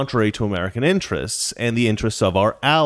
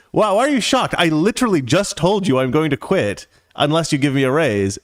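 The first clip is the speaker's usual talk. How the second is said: raised pitch, incredulous retold comeback; exasperated retort; 6 semitones higher than usual. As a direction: retell it like you can't believe he's shocked. Retelling his exasperated comeback to boss.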